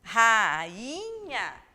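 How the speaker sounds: Rainha said loud with the a and i apart; syllables split. Shown as a demonstration. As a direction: sound Rainha as ra-i-nha. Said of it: "as duas têm um som mais forte".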